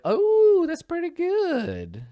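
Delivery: real delighted surprise; pleasantly surprised. Real, not acted. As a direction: squeak it out, delighted.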